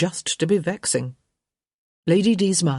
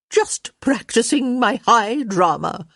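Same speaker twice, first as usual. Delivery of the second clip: higher, grandly theatrical delivery; sighing high drama; 7 semitones higher, 4 dB louder than usual. A narrator's reading. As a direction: play the suffering stage heroine. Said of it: "Lady D sighed, letting one hand flutter".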